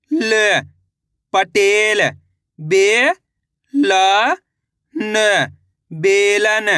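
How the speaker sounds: each syllable sounded out, then the word blended; teacher sounding out syllables for a class; syllable-by-syllable reading. Shown as a demonstration. Reading syllables aloud for kids. (अक्षर-अक्षर करके पढ़ना)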